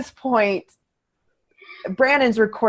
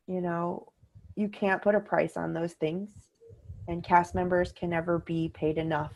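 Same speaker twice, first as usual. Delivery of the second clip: ordinary talk, nothing marked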